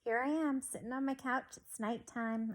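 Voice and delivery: ordinary delivery, nothing marked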